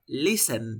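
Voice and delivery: "Listen" with tongue up and no puff of air, ending on N; "Listen" with no breath released at the end; model pronunciation. Shown as a demonstration. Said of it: "No botes aire, deja tu lengua arriba".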